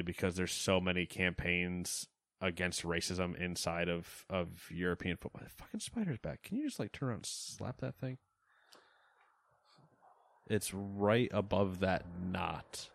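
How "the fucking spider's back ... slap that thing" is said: high, hushed alarm; alarmed urging. Real, not acted. Spotting the spider, urging Matt in high hushed alarm. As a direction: hiss the spider warning.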